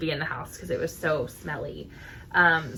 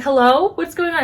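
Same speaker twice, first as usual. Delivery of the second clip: indignant challenge; 9 semitones higher, 6 dB louder than usual. Retold or imitated. Aunt confronting the yelling woman.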